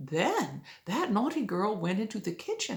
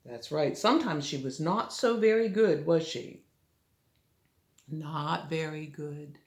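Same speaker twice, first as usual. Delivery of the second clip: usual speaking voice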